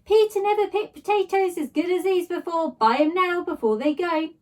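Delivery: high, loud trader's call; a stallholder shouting to passers-by; market stall shout. A narrator's reading. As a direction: holler your wares over the crowd. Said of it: "a woman shouting".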